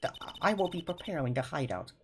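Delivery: first shaky try at a Russian waiter; a tentative mock Russian accent; Russian accent attempt. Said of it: "how do I do a Russian accent?".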